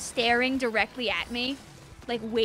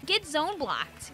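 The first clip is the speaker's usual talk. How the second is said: ordinary talk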